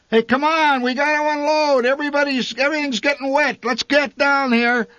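high, loud shout through the rain; father shouting. A narrator's reading. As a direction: yell to the family to come unload. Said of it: "My father shouted through the trees".

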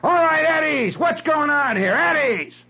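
high, loud barked command; sudden loud demand to know; authority breaking in. Retold or imitated. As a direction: bellow it like an officer charging in on a brawl. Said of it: "you hear this voice says".

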